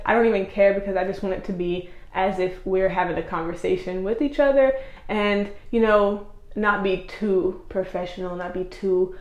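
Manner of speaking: normal talking voice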